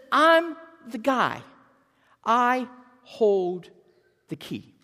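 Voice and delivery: bold, drawn-out claim of power; emphatic proclamation. Acted. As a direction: proclaim it with authority, each word weighted. Preacher voices Jesus declaring his authority.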